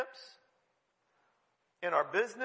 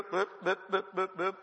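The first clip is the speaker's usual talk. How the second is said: short, even boops; mimicked routine beeps. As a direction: boop along mechanically, step by step. Mimics going through a daily routine.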